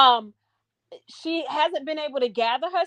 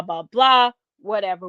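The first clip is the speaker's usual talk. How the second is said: ordinary talk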